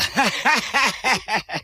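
high, smug soldier's laugh; gloating laugh. Acted. Soldier dreaming of promotion, gloating laugh. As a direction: laugh as if the promotion is already won.

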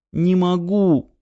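высоко и медленно, с обидой; выкрикнуть обиженным детским голосом; обиженный выкрик. A narrator's reading. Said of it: "с обидой выкрикнула девочка". (девочка с обидой выкрикивает Крылову)

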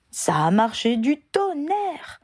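voix aiguë, lente et ravie; lance-le avec enthousiasme; enthousiaste. A narrator's reading. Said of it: "répondit Angelina avec enthousiasme". (avec enthousiasme, voix haute et lente)

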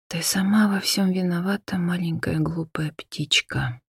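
breathless whisper, slowing down; eerie whisper. Acted. A voice in her head taunting her, whispered. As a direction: breathe the words like a haunting memory. Haunting remembered words.

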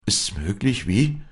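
high, rising cry of disbelief; astonished exclamation. A narrator's reading. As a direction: exclaim as a shocked priest. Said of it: "rief der Geistliche".

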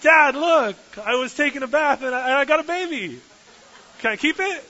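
hammed-up whiny squeal, laughing at the end; begging princess. Princess shouts to Pharaoh to keep the baby, high whiny shriek. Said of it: "she takes Moses and she goes".

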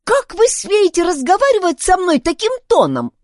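high, loud, outraged demand; indignant outburst. A narrator's reading. Girl confronts the old woman, loudly indignant. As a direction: flare up at the insult.

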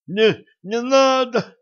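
very high, loud strangled cry; strangled plea. Acted. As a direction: beg in a choked, panicked voice. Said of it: "задушённо прохрипел".

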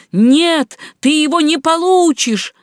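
high, loud, defiant cry; taunting shout. Acted. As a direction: yell it back while fleeing. Стряпчий дразнит черта. (дерзкий громкий выкрик)

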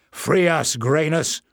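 loud angry roar. A narrator's reading. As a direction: bellow the threat at the captors. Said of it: "roared Bada".

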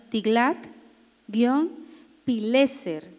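"Tiglat-Pileser" very slowly, broken into separate chunks; spelled as it sounds. Shown as a demonstration. Teacher dictating the king of Assyria's name, very slow and segmented. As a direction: dictate "Tiglat-Pileser" with long gaps between the parts. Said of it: "como suena".

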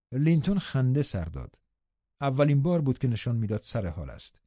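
plain reading voice

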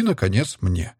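ordinary delivery

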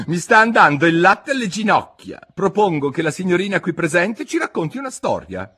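drawling, fed-up hare; March Hare. Acted.

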